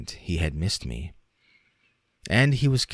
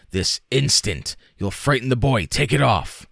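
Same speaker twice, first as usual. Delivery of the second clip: louder, higher, rising alarm; alarmed father shouting; 6 semitones higher, 4 dB louder than usual. A narrator's reading. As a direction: demand it in a growing panic. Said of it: "I cried".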